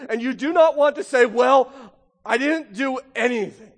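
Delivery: loud, driving pulpit voice, rising in pitch; preaching at full force. Acted. Preacher warns his congregation, loud and full force. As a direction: hammer the warning home to the congregation.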